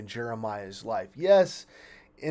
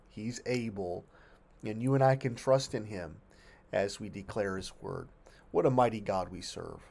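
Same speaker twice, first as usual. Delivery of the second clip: ordinary delivery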